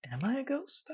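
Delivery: high, soft whispered question; a hushed, wondering question. A narrator's reading. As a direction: ask it under your breath. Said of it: "I whisper".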